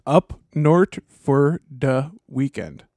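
slow, drawn-out Minnesota "up north for the weekend"; Minnesota accent. Shown as a demonstration. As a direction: drawl the Minnesota vowels in "north". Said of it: "a basic Minnesota accent".

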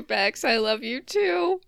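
ordinary delivery

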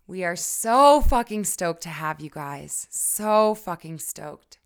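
loud, slow and gushing; bursting with excitement, stretch "so"; excited. Real, not acted. Host telling listeners how thrilled they are, loud and slow. Hosts hyping new program.

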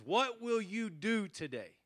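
high rallying call to the troops; William Wallace. Acted. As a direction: throw the question out to an army, pitched high. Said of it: "the actor playing William Wallace responds".

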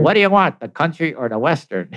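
mock-yelled wisecrack, starting high; smart-ass yell. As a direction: call back like a smart aleck, half-shouted. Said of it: "So smart ass me yells out".

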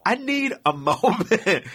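high, slow, spaced-out insistence; hammed-up slow pleading; insistent plea. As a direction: draw out each word, breaking into a laugh. Repeats plea, exasperated, in story.

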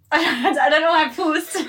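laughing through the words; embarrassed giggling while talking. Real, not acted. Caught hesitating about Birmingham.

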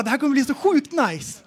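ordinary delivery

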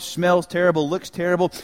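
usual speaking voice

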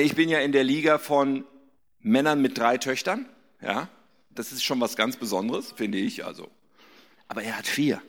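usual speaking voice

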